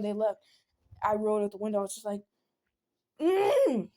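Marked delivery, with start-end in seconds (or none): 3.2-3.9 high, frustrated growl into a complaint; retold angry outburst. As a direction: groan loudly, then protest at a high pitch. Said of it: "I was just very angry".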